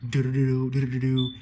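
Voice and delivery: loud ominous do-do-do chant; a pounding doom-doom sound effect; chanting hum. Retold or imitated. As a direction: chant it like a summoning drone. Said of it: "being like".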